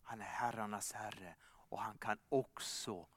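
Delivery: soft, low, gentle invitation; intimate appeal. Acted. Preacher inviting each listener, softly and sincerely. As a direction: draw the listener in quietly.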